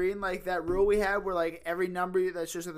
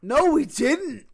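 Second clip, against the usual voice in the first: slow, loud protest; emphatic denial; 8 semitones higher, 5 dB louder, slower than usual. Real, not acted. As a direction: drag out the denial.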